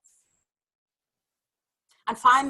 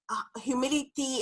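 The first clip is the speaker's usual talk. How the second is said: normal talking voice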